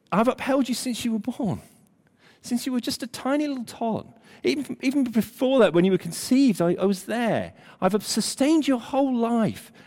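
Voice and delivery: tenderly cherishing, without raising volume; tender, maternal. Acted. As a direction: reassure like a mother to her child. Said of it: "in this very tender image".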